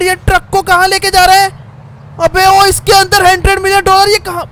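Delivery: sudden alarm, voice jumping up; alarmed outburst. Real, not acted. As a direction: blurt it out as the money drives away. Sees Jimmy drive off truck.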